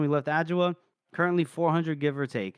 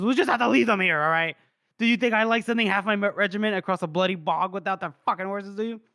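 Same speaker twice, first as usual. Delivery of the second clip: louder and higher, fast exasperated rant; frustrated outburst; 6 semitones higher, 5 dB louder, faster than usual. Retold or imitated. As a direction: snap at the sergeant in frustration, picking up speed. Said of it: "he flings up his hands in frustration".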